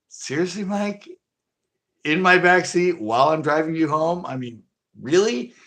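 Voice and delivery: high, disbelieving, laughing complaint; incredulous complaint. Retold or imitated. Driver scolding his friend Mike in the back seat, high and incredulous. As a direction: pitch it high like you can't believe it.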